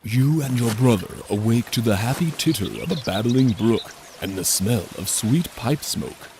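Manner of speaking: plain reading voice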